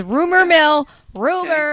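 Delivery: high, loud jingle-like announcement; hyped segment intro. Acted. Co-host kicks off the gossip segment with a hyped intro. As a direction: sell the segment name like a show host.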